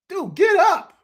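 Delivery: high, loud jeering call; mock yell at fallen player. Real, not acted. Host jeering a fallen coach on replay, loud mock yell. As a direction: shout at him to get up.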